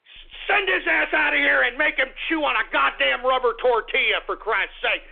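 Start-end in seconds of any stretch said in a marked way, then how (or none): none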